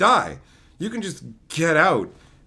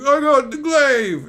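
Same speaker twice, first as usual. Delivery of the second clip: slow, higher, louder hammed-up hero cry; mock triumphant hero; 7 semitones higher, 6 dB louder, slower than usual. Streamer mocking the hero pulling out the glaive, hammed-up triumph. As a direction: ham up the hero's big triumph. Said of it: "He's like".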